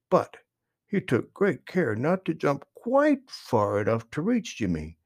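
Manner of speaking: usual speaking voice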